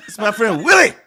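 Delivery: "Willie" called out high and loud in a put-on accent; hammed-up high accent on WILLIE. Shown as a demonstration. Introducing his friend Willie, loud exaggerated accent. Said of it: "gotta say it with an accent".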